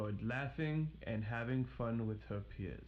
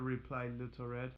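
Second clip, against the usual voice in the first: ordinary delivery, nothing marked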